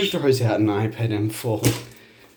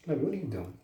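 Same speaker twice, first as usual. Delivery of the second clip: usual speaking voice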